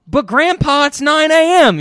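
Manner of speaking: put-on high, loud whiny kid voice; a high, loud grandkid pleading with Grandpa at bedtime. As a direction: whine like a grandchild protesting. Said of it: "and they're like".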